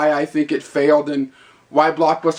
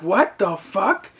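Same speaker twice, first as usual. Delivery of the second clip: slow, drawn-out, higher disbelief; mock bafflement; 4 semitones higher, much slower than usual. Clerk stuck counting a customer's coins, slow mock bafflement. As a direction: stretch each word into a baffled question.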